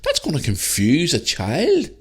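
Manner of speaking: high, slow, stunned disbelief; as if seeing a drag reading at a nursery school, aghast; incredulous outburst. Pretend. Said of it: "And I go".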